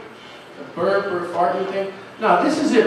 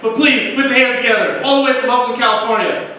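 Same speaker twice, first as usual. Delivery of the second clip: club announcer; 2 semitones higher than usual. Acted. Emcee introduces the next comedian, hyped. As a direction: keep the room buzzing as you bring him up. Host hypes next comic.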